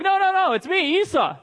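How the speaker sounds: high, hurried denial; high-pitched pretend protest; mock protesting Jacob. Jacob insists he is Esau.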